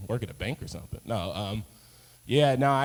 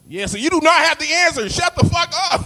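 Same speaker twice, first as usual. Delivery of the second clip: high, loud yelling host; mock yelling talk-show host; 14 semitones higher, 8 dB louder than usual. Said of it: "he just yelling at all his guests".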